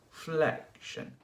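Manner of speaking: "flexion" starting high and dropping; falling stressed FLEC. Shown as a demonstration. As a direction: pitch "flec" at the top, then let it fall. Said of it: "starts from the top and goes down".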